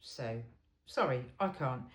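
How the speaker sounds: ordinary delivery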